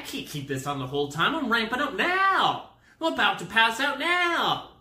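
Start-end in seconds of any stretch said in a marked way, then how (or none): 1.3-4.7 high, loud comic freak-out; hammed-up panicked shouting; mock panic. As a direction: yell it high as panic takes over. Acting out panic to nurse.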